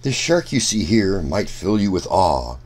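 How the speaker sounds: ordinary talk, nothing marked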